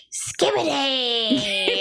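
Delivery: put-on drawn-out, high goofy kid cry; stretched-out mock kid yell; silly kid outburst. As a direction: ham up a little boy's nonsense shout. Kid's silly outburst retold.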